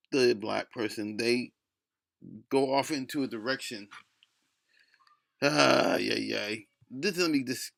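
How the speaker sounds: usual speaking voice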